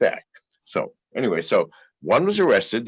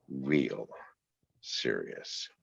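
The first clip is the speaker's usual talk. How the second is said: low, hushed, retold warning; slow down and drop it very low and quiet; grave warning; 8 semitones lower, 10 dB quieter, much slower than usual. Security chief warning a friend of danger, grave and hushed.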